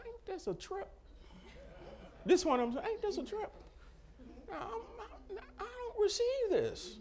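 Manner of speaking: high, incredulous "Ain't this a trip?". Retold or imitated. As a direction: act out the disbelief up high. Disbelief at diagnosis, retold.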